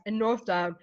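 ordinary talk

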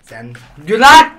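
slow, loud, pushy order; loud demand. Real, not acted. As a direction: hammer the order home. Demanding a laugh.

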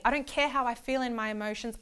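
normal talking voice

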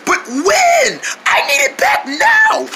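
high, hoarse, impatient demand; lost voice. Acted. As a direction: strain to insist with no voice. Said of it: "I lost my voice".

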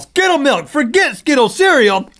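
high squealing pitch; excited high voice. Real, not acted. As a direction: hype it up, squealing.

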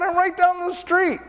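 high-pitched quoted answer; eager reply. Retold or imitated. Pastor tells the patient he is close by, high eager reply.

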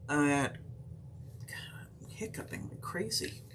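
soft slow whispered aside; hushed breathy whisper. Real, not acted. Whispered aside while hiccuping.

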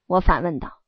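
plain reading voice

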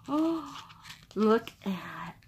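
hushed, breathy whisper of awe; barely voiced, marvelling; awed whisper. Real, not acted. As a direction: whisper it in admiration. Awed by the calendar pictures.

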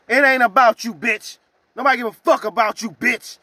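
loud, high telling-off; angry rant. Real, not acted. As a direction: put her in her place loudly. Ranting at coworker.

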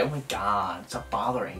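put-on very low-pitched, sulky American teen; grumpy American teenage girl. Mocking the friend's American accent, grumpy teenage girl. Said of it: "like a really grumpy American teenage girl".

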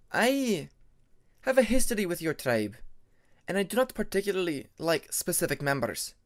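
a proud dragoness setting the record straight; stern Ice Wing dragoness. Acted. Ermine's voice held by narrator.

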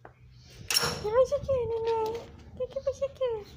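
high, slow, cooing pet voice; baby talk to dog. Acted. Woman cooing at her little dog, high baby talk. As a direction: coo at the puppy like a baby.

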